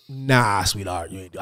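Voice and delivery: low, soft acted put-down; quiet refusal. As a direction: turn someone down softly, pitched low. Voicing a real brother's refusal.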